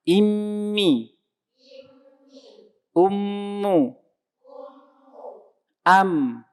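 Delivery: Immi and Ummu with a long nasal hum on the doubled m; ghunnah demo. Shown as a demonstration. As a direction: hold the mm in Ummu, humming through the nose.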